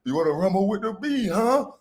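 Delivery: lightly voiced queen taunting over wine; mock queen taunt; imitating the Queen. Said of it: "I can see Queen right now".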